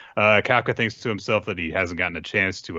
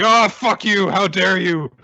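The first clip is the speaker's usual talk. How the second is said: much higher, louder hammed-up outburst; mock outrage; 12 semitones higher, 6 dB louder, slower than usual. Narumi losing his temper at Hoshina, hammed-up yell. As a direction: explode in mock fury. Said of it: "He's like".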